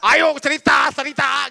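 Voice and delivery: high, insistent nagging; mock pushy urging. Friend forcing someone to share their trouble, high mock nagging. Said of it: "jangan maksa".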